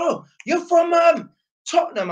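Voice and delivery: high-pitched excited Cockney impression; Cockney accent. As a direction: put on a high Cockney geezer voice. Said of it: "that's how I'm doing a Cockney accent".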